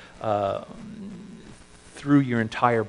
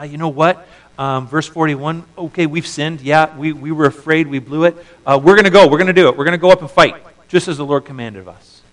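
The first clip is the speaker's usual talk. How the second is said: loud, higher, pumped-up resolve; rash resolve; 4 semitones higher, 9 dB louder than usual. Retold or imitated. Israelites telling Moses they will fight, loud and eager. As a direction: talk yourself into charging in. People voiced deciding to fight.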